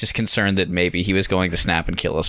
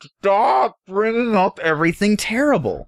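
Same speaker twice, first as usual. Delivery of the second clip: high, drawn-out mock plea; retold exasperation; 10 semitones higher than usual. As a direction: despair at a friend's tactlessness, stretched and pitched way up. Inner dismay at friend's question.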